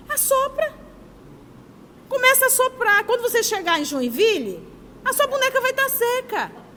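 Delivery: put-on high, lively coaxing of a child; sing-song push to a crying little girl. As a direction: cheer the girl into blowing on her doll.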